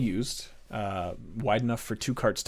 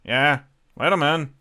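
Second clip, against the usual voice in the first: much higher, louder offhand guard voice; second guard; 5 semitones higher, 9 dB louder, slower than usual. Acted. Other guard telling the first to let the party in, loud. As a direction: wave them through from behind. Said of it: "you hear".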